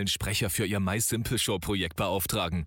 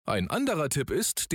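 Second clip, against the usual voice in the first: ordinary talk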